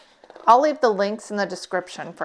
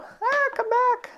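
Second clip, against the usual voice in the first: high-pitched coaxing. Real, not acted. Tape rolls away from her.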